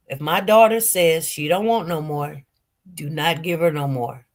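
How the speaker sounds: firm mother. Retold or imitated. Mother backs daughter, retold.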